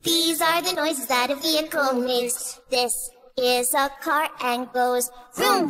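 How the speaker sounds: normal talking voice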